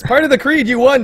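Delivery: high, loud winner call; excited announcement. Real, not acted.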